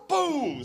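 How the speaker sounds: high, loud "boom"; imitated smash; crash noise. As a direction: punch out the sound of impact.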